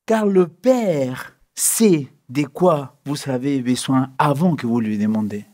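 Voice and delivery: slow, low, weighty reading of the verse; solemn scripture reading. Shown as a demonstration. Preacher reading the bolded verse slowly and gravely to the listeners. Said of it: "Regarde la suite en gras".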